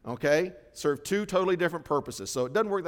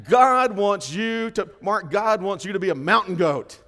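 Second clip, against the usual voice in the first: loud, high burst of preaching joy; excited exclaim; 5 semitones higher, 10 dB louder than usual. Real, not acted. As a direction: shout it out like good news.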